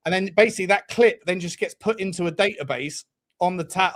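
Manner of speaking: normal talking voice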